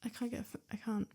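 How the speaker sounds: plain reading voice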